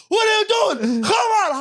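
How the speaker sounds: high strained shout; dad yelling. Retold or imitated. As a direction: scream at the son from downstairs. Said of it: "My dad goes".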